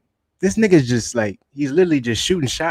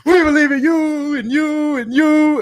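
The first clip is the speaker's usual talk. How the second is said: mock Biden ad; 13 semitones higher, 5 dB louder than usual. Retelling Trump's ad of young Biden to friend, hammed-up high voice. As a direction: ham up a grand old-man promise. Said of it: "He was like".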